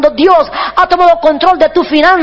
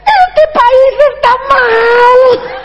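very slow, high wail; old president bawling on TV, hammed up; imitated crying; 9 semitones higher, much slower than usual. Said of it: "y se ponía a llorar".

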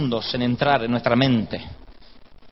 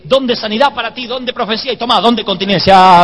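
higher, louder mock bestowal of gifts; visiting preacher; 5 semitones higher, 9 dB louder than usual. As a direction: hand out each gift like a forceful preacher. Mimics visiting preachers handing out gifts. (imitando a un predicador, con voz alta y enérgica)

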